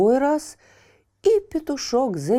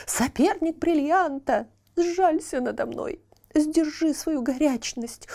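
high, slow, pleading praise; half-chick pleading with the fire; solemn begging; 3 semitones higher than usual. Acted. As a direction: flatter the fire slowly, beseeching.

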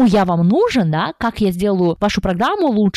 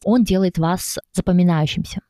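usual speaking voice

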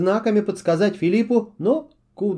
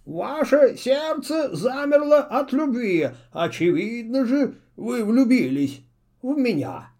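high, slow owl voice, smug; owl servant; 6 semitones higher than usual. A narrator's reading. Owl tells the witch she loves him, proud.

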